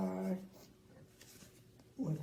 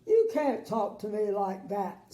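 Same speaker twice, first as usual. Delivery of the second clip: louder, mild sob; sobbing king; 7 dB louder than usual. Acted. King sobs at Little Miss Sunshine, louder. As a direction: sob it like a hurt child. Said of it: "sobbed the king".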